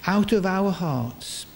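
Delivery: ordinary delivery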